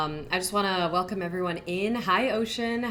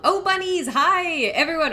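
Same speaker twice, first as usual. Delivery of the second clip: excited greeting; 9 semitones higher, 9 dB louder than usual. Real, not acted. Greeting a chat friend.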